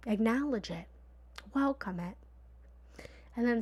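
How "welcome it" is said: ordinary talk, nothing marked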